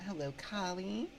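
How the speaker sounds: ordinary delivery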